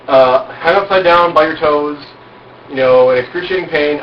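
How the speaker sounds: usual speaking voice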